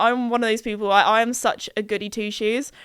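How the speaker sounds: ordinary talk, nothing marked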